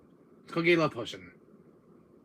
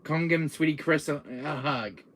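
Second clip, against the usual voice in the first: ordinary talk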